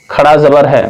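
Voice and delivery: ordinary delivery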